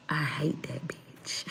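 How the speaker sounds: slow hushed whisper; whispered under the breath; whispered grudge. Pretend. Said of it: "every time he see me just be like".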